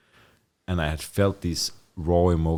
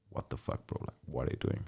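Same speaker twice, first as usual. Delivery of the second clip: a soft whisper, much softer; hushed big brother; 4 semitones lower, 12 dB quieter than usual. Retold or imitated. Big brother whispering at him to calm him down. Brother's calming words voiced.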